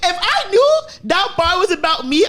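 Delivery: normal talking voice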